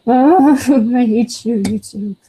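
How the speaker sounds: ordinary talk, nothing marked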